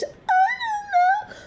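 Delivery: very high, slow, whiny squeak with a crying edge; mock high-pitched whine. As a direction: mimic her high-pitched crybaby voice. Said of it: "It's like this high pitch".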